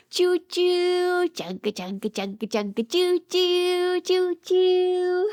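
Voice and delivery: put-on chug like a toy train for kids; sing-song train imitation; choo-choo train sounds. Train noises for children.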